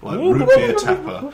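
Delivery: high, slow, laughing words. Real, not acted. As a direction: squeeze it out through laughter. Joking metaphor while laughing.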